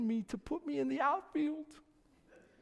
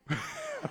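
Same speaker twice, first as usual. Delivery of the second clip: a high, soft knowing laugh; son's knowing laugh; 6 dB quieter than usual. Retold or imitated. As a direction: laugh like a son who sees the lecture coming. Son laughs at dad's talk.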